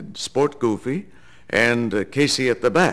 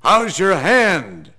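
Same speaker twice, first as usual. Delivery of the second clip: higher, slow mock yell across the freeway; slow, raised retold shout; yelled greeting; 8 semitones higher, 4 dB louder, much slower than usual. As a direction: call it out slowly like from a passing car. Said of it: "he yells out".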